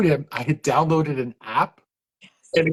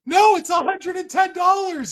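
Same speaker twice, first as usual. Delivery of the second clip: put-on insistent kid; 14 semitones higher than usual. Kid insists to his dad.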